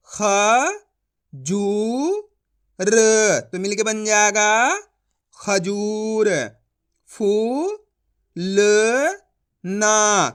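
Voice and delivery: slow, loud syllable-by-syllable reading; syllable drill. Shown as a demonstration. Teacher to students reading Hindi words, slow syllable drill. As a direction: sound out each syllable then join the word.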